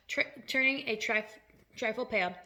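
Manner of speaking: plain reading voice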